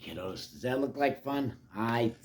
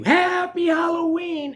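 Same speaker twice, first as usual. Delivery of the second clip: high, loud holiday shout; joyful cry; 9 dB louder than usual. Acted. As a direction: burst in shouting a greeting. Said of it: "And she cries".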